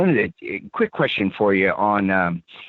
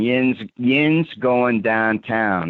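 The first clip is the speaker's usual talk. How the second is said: slow, drawn-out Pittsburgh "Yins"; mock Pittsburgh accent; 4 semitones higher, much slower than usual. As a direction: imitate a Pittsburgh local heading downtown. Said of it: "they say".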